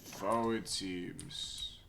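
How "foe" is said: ordinary talk, nothing marked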